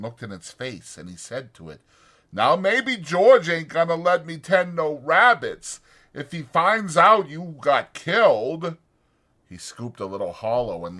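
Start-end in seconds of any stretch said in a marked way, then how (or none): 2.3-8.8 loud, anxious simple man; Lenny's worried voice. A narrator's reading. Lenny frets to himself over the dead puppy, loud and anxious. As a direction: fret out loud about George finding out.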